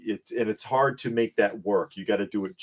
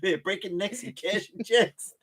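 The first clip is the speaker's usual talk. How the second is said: drug dealer voice; 11 semitones higher than usual. Pretend. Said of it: "[name]'s drug dealer voice".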